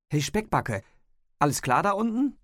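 hoher, lauter Zuruf nach unten; Rufen. A narrator's reading. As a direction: hinunterrufen mit steigender Frage. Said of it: "rief sie". (laut rufend)

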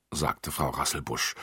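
ordinary delivery, nothing marked

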